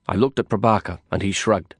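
ordinary delivery

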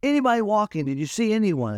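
high, loud furious ranting; irate outburst. Retold or imitated. Owner ranting about his brand new truck, high furious yell. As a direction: rant in outrage at the damage. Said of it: "he was irate".

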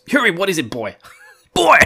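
high, loud calling the dog; mock shout. Rand calling Hurin like a dog, loud mock shout. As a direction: bark boy like to a dog. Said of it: "Rand goes".

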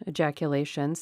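ordinary delivery

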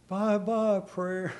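soft slow-motion farewell; mock slow motion. Preacher mimics a slow-motion farewell to prayer, softly drawn out. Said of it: "they show it in slow motion".

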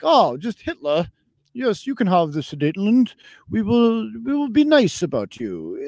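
exaggerated polite British appeaser; Neville Chamberlain. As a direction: play a meek, obliging British statesman. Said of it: "Neville Chamberlain, ... a little British history there".

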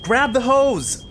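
high, slow shouted command; barking order. Acted. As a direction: bark it out urgently. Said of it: "I barked".

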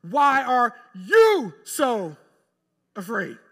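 "you" held long, high and loud; slow it right down and punch "you"; stress on YOU. Acted. Jesus stops and rebukes his disciples, stress on "you". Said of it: "And Jesus stops".

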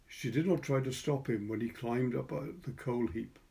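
ordinary delivery

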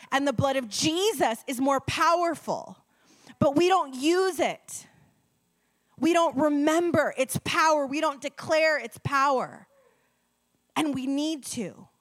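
building sermon fervor; full-force preaching. Acted. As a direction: preach it out to the congregation.

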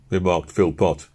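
ordinary delivery

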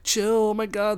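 mock the girls trying to calm someone down; put-on high-pitched exasperation; imitated girls pleading. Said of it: "then they're both like".

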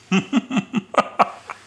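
short high giggle; high laugh. Real, not acted. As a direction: chuckle at your own joke. Laughs at own joke.

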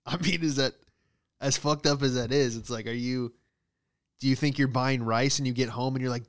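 ordinary talk, nothing marked

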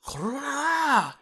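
high, drawn-out street-ranter scream; wailed shout with long stretched vowels. Retold or imitated. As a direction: imitate a man screaming into a loudspeaker. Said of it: "He was screaming into this thing".

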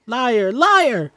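put-on high, loud child's accusing yell; furious little girl shrieking liar; shouting liar. Mercy accuses sister.

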